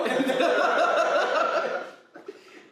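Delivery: long loud high-pitched laugh; howling laughter. Real, not acted. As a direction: break into a big laugh. Laughing at the story.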